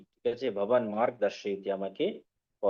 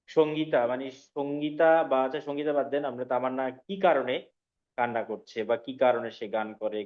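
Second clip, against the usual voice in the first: ordinary talk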